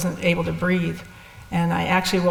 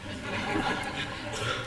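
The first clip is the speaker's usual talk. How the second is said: soft, breathy laugh; quiet chuckle; 11 dB quieter than usual. Real, not acted. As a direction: chuckle softly at your own story. Laughs at her own retold curse.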